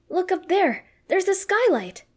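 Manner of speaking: high, loud excited shouting; high-pitched feminine scream. A narrator's reading. Ash spotting the skylight, screaming in a feminine voice. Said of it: "in such a high-pitched feminine voice".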